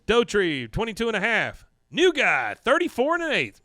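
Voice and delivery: loud, high score announcement; contest announcer. Acted. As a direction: belt out the results to a crowd. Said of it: "they hold up the sign, and he says".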